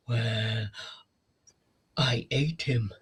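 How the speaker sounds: lightly voiced monster reveal; creepy monster reveal. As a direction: let the confession creep out. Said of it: "She goes".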